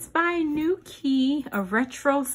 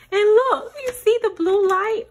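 excited; 9 semitones higher, 7 dB louder than usual. Real, not acted. Light comes on.

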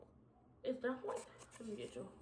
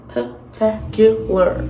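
slow, savoured "Spectacular."; drawn out; much slower than usual. Pretend. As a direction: stretch "Spectacular." long and proud.

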